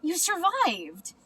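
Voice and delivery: high, slow joyful cry; excited cry. Acted. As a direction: marvel at her return. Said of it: "cried Stellaluna".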